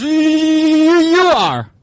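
mock cowed zebra. Zebra answers the lion.

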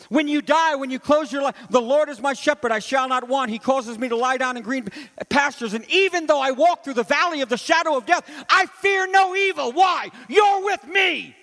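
soaring sermon climax, slowing on the last words; preaching at full force. Acted. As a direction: cry it out to the rafters.

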